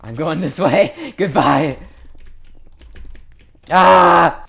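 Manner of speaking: real panicked yelling and screaming; shouting in a frantic panic while dying; panicking gamer. Real, not acted. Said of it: "I'm not loud when I record my videos".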